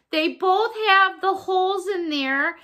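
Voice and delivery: usual speaking voice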